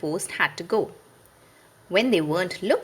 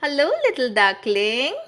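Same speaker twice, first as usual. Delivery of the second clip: higher, tender hello; sweet greeting to duckling; 6 semitones higher, 4 dB louder than usual. A narrator's reading. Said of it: "said Wendy".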